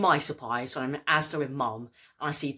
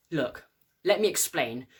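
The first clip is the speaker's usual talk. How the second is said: ordinary talk, nothing marked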